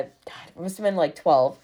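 ordinary delivery

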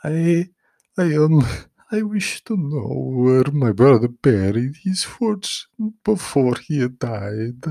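high, halting, slow frightened voice; terrified stammer. A narrator's reading. Guido Baldo answering the demon, high frightened stammer. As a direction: stumble out the wish in fear. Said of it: "Guido Baldo stammered".

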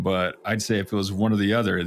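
ordinary delivery

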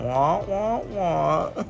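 muffled wah-wah adult drone, imitating a cartoon teacher; Charlie Brown teacher. Host mocks the lighthouse keeper's boring story in wah-wah teacher drone. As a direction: mimic the boring storyteller as trumpet-like wah wah sounds. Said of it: "reminded me of Charlie Brown and the teacher on Charlie Brown".

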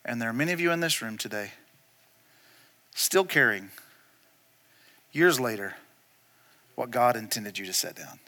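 low, soft, weighty application; hushed solemn preaching. Real, not acted. Preacher turns parable on congregation, hushed and solemn. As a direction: drop low and let the pauses hang.

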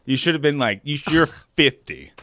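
ordinary delivery

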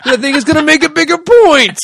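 high, loud laughing sitcom voice. Acted. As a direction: ham it up like a sitcom. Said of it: "That's your sitcom voice".

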